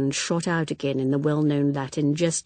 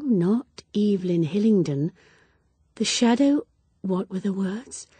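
plain reading voice

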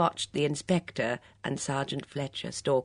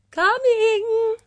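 high, thin answering call; thin cry; 9 dB louder than usual. A narrator's reading. Friend answering from the orchard, thin high cry. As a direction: call back from far down the orchard. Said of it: "floated back a thin cry".

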